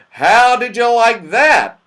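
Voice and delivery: high, loud, drawn-out question; loud hail. A narrator's reading. Moonface greets children off the basket ride, calling loudly. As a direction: call it out proudly, high and slow.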